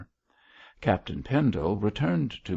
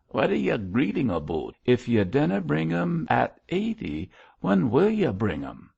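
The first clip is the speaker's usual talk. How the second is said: higher-pitched Scottish sexton voice; bad Scotch accent; 6 semitones higher, 4 dB louder than usual. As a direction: put on a rough-and-ready Scotch accent. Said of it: "My Scotch accent is bad".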